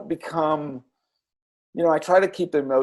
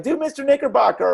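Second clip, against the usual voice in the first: lightly mimicked kid's request, higher and fast; imitating a child; 11 semitones higher, 3 dB louder, faster than usual. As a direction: beg like an eager little kid. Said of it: "and they're like".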